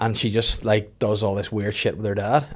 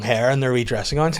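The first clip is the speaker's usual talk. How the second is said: ordinary delivery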